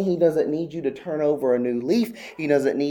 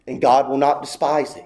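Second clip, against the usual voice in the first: normal talking voice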